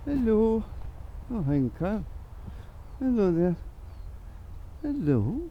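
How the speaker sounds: sing-song hello to a beautiful animal; high pet-talk greeting. Real, not acted. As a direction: greet the pony like a sweet pet.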